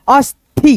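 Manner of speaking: अस्थि in two beats, as-thi; aspirated th. Shown as a demonstration. Teacher reading joined स and थ, अस्थि as अस्-थि. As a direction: puff the थ in अस्थि after a closed स. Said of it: "थ में जुड़ा हुआ है".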